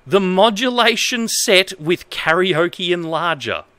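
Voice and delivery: reads broken manual text in a lightly formal voice; straight-faced reading of nonsense instructions; mock-earnest manual reading. Reads bad manual translation aloud.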